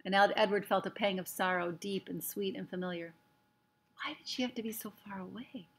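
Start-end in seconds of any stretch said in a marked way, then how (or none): 3.9-5.7 hushed, sorrowful thought; wistful whisper. A narrator's reading. Rabbit longing for far-away Sarah Ruth, wistful whisper. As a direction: whisper it softly, full of longing.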